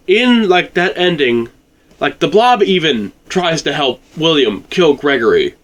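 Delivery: usual speaking voice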